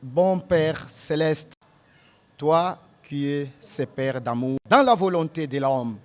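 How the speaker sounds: ordinary talk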